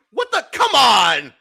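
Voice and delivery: high yelp then loud protest; shocked outburst. Real, not acted. Stunned streamer yelling at the chatbot's jab.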